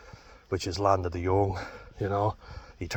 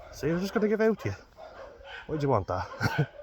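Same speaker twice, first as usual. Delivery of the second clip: talking to the dog; 8 semitones higher than usual. Real, not acted. Talking to his dog on walk.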